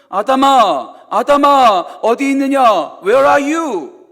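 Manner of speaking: loud, ringing call to Adam. Retold or imitated. God calling out loudly to hidden Adam. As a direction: call out to Adam across the garden. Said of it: "뭐라고 말씀하시죠?".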